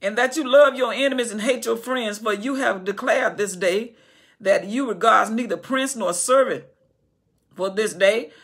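loud, rising preacher's cadence; preaching. Acted. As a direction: preach it out to the congregation. Preaching at full force.

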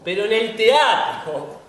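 grand drawn-out "teatro"; emphatic declaration. Acted. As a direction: declaim it like a credo. Repeats phrase for emphasis.

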